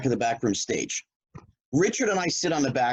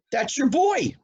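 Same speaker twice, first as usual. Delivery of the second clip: Robin egging him on. Retold or imitated. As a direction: urge him into the fight with a grin.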